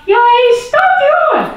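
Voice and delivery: high, loud furious shriek. Acted. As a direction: scream at the boy. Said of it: "gilde mama".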